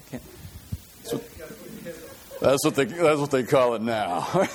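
laughing through the punchline; chuckling repeat; laughing retelling. Real, not acted. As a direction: giggle out the punchline. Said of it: "repeating punchline laughing".

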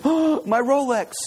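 gasped, high-pitched dismay; gasp, then lament it as a punchline; mock horror. Punchline, man discovers lost watch.

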